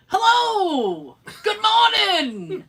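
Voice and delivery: high, slow, sing-song good morning; cheery cop greeting. Retold or imitated. Cop greeting a nervous juror in a high, slow, sing-song voice. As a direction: greet him like an overly sweet cop.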